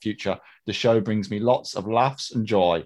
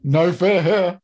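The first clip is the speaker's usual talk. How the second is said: loud, raised mock-brave boast; mock bravado; 6 semitones higher, 5 dB louder, slower than usual. Co-host joking about a dangerous game, mock bravado. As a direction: play fearless, pitched up and loud.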